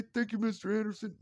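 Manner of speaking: put-on high cheerful child's thanks; kid voice. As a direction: chirp it like a small boy.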